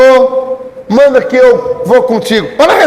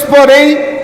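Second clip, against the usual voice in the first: usual speaking voice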